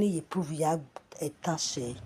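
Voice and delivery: ordinary talk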